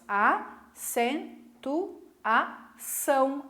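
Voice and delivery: very slow "acentuação", a pause after each syllable. Shown as a demonstration. Teacher separating the word for students, syllable-by-syllable "acentuação". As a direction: split "acentuação" into a-cen-tu-a-ção. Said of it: "Se eu for separar em sílabas".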